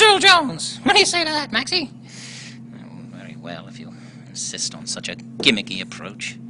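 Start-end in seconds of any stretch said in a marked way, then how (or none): 2.7-6.4 deep, dry ventriloquist dummy voice; a lugubrious puppet, grudgingly agreeing; very low, reluctant dummy. Acted. Dummy Maxie grumbles.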